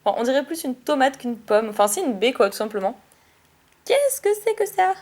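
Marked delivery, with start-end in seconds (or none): 3.9-5.0 surprised. Real, not acted. Puzzled at a card's berry.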